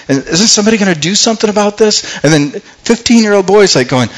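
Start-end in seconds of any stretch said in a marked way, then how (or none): 0.1-2.2 fast, high-pitched exasperated question; incredulous rush of protest; indignant appeal. Retold or imitated. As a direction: demand action, urgent and pitched up. Acting out David's indignant appeal.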